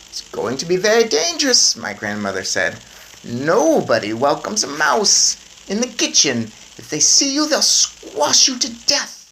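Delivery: high, brisk grandmother voice. A narrator's reading. Grandmother planning with her grandson, high brisk voice. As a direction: plan it out like a determined granny.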